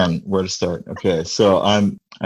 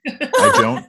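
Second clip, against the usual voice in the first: words broken up by a laugh; giggling mid-sentence. Real, not acted. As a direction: laugh your way through it. Laughing while talking about age.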